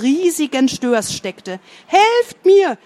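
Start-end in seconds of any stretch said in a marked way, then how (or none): 1.9-2.8 high, loud desperate shout; desperate cry for help. A narrator's reading. Boy stuck with a huge sturgeon, desperate shout. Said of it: "schrie der Junge verzweifelt".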